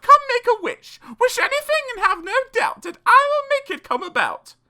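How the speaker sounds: very high, loud fairy voice; a bright high magical fairy promising wishes; fairy godmother. A narrator's reading. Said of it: "she cried".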